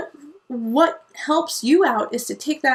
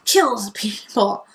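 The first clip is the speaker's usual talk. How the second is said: mock accusation; 6 dB louder, slower than usual. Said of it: "and you go".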